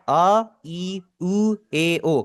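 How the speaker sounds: आ, ई, उ, ए, ओ each drawn out clearly; vowel demo. Shown as a demonstration. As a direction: sound out the five vowels one by one. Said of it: "जरा इन्हें गौर से सुनिएगा". (स्वरों का साफ़ उच्चारण)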